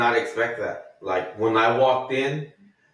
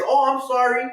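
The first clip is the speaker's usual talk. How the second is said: much higher, sugary put-on voice; apologetic stranger; 6 semitones higher than usual. The man who touched his shoulder apologizes, high sweet voice. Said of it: "and the guy's".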